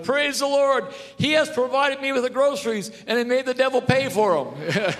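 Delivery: put-on high loud joyful old lady; old lady crying out. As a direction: shout for joy as the old woman.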